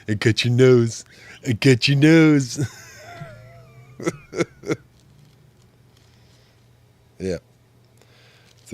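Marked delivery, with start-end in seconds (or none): 0.1-2.7 loud playful teasing, high; playful got your nose. Pretend. 2.7-4.8 high squealing laugh. Real, not acted. Laughing at his own joke.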